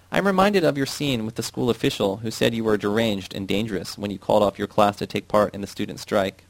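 usual speaking voice

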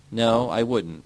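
usual speaking voice

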